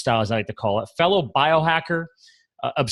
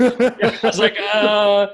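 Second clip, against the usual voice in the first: laughing; 8 semitones higher, 5 dB louder, much slower than usual. Real, not acted. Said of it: "laugh heard".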